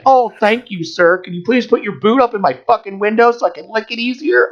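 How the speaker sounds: high, sarcastically servile plea; mock gratitude. Driver mocking the grateful reply a cop expects, sarcastic. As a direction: fawn sarcastically with the pitch way up. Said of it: "expect me to be like".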